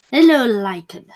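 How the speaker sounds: ordinary delivery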